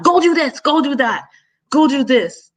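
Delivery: mock bossy yell, pitched high; retold yelling. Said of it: "she yelled, yelled a lot".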